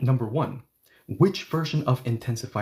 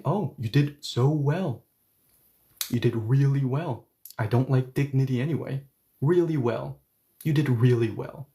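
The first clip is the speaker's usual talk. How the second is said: sarcastic praise. Shown as a demonstration. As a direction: lay the fake praise on, phrase by phrase. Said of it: "or am I being sarcastic?".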